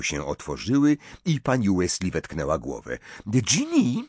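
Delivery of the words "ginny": plain reading voice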